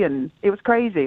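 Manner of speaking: ordinary delivery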